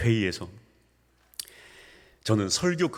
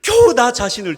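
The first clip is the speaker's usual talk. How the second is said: full-force preaching; 12 semitones higher, 8 dB louder than usual. Acted. Pastor stressing his turning point to the church, loud. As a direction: lean hard into each word.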